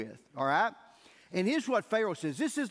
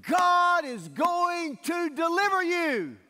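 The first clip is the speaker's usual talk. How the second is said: high, slow, ringing proclamation; proclaiming shout; 9 semitones higher, 6 dB louder, slower than usual. Retold or imitated. Moses proclaims deliverance to the Hebrews, high slow shout. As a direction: call it out like a prophet to a crowd.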